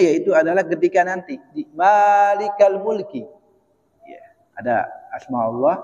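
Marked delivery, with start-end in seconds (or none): 1.8-3.2 "Malikal Mulki" with a long, drawn-out "Maa"; long mim vowel. Shown as a demonstration. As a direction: hold the first vowel of "Malikal" before going on. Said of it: "Kalau panjang, mimnya". ("Malikal Mulki" dengan mim dibaca panjang)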